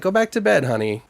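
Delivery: lightly acted brush-off, starting high and dropping; a hint of a mom shooing her daughter; dismissive mother. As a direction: gently wave the child away, pitch up then falling. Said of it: "she just blows off her daughter".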